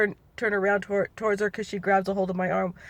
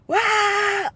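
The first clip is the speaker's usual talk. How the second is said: short high mock wail, not a real yell; 12 semitones higher than usual. Shown as a demonstration. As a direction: toss off a silly high yell to show what it wasn't. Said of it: "I'm not saying that everybody's like".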